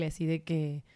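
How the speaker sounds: ordinary talk, nothing marked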